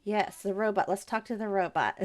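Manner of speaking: normal talking voice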